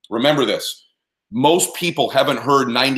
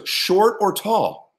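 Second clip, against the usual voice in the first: slow insistent repeat; 5 semitones higher, much slower than usual. Retold or imitated. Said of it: "He goes".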